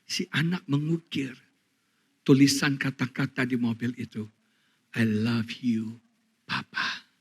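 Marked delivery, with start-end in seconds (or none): 4.9-7.1 slow, hushed and soft; soft, slow whispered child's message; tender whisper. Acted. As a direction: breathe it like a child's love note. Child's carved message read tenderly.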